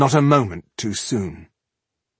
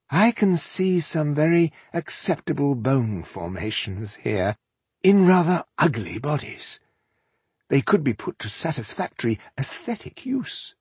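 higher, coolly appraising elf villain; haughty elf aesthete; 5 semitones higher than usual. Acted. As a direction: appraise their bodies like art materials.

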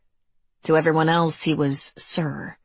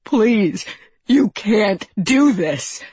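louder, higher drawn-out plea; acted panicked begging; crying out in desperation; 4 semitones higher, 5 dB louder, much slower than usual. A narrator's reading. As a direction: beg desperately, stretching the words. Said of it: "he cried".

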